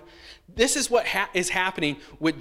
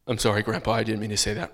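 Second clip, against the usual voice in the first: low, reluctant mumble; boy grudgingly apologising to grandpa; forced apology; 7 semitones lower, faster than usual. Retold or imitated. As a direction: say it like you're made to. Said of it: "Mom is forcing me to say this".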